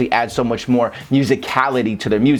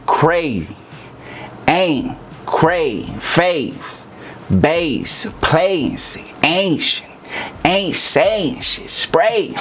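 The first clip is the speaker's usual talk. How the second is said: isolated, drawn-out "cray", "ain't", "face", rising in pitch toward "spray"; stressed syllables only; 4 semitones higher, much slower than usual. Shown as a demonstration. Rap coach drilling stressed syllables, each one punched separately. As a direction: hit each long A alone, pausing after "cray" and "ain't". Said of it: "those are the stressed syllables".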